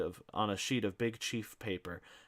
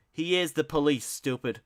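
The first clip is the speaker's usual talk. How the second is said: angry retort; 7 semitones higher, 8 dB louder than usual. A narrator's reading. Riley retort.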